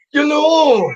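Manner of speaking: high Scottish Billy Connolly voice; lightly hammed-up Glaswegian comedian. Asked on air for a wee Scottish accent, playful Billy Connolly impression. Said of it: "I could do a Billy Connolly".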